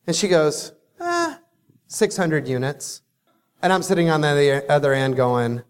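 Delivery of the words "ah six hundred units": said through a yawn. Retold or imitated. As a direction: yawn while saying it. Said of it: "hence the yawning".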